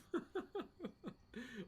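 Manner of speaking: breathy high giggle at his own joke; giggling at his own line. Real, not acted. Laughing at himself on stream.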